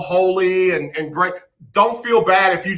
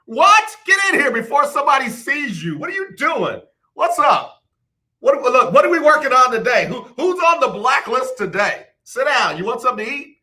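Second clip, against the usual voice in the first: high, eager hostess voice greeting a guest at the door; excited welcoming host; 6 semitones higher than usual. Acted. Hostess welcoming Raymond Reddington in, high and excited. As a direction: play the thrilled host pulling him inside and feeding him.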